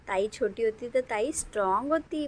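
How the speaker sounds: ordinary talk, nothing marked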